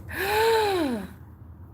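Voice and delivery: long high-pitched gasp; overwhelmed gasp. Real, not acted. Collector seeing a longed-for doll, high shocked gasp.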